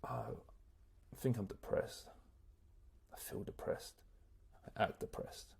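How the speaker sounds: whispered, lifeless and soft; mock depressed. Acting out feeling depressed: soft lifeless whisper. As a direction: sink into gloom, barely voiced. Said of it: "I'm like".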